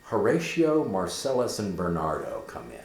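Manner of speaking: ordinary talk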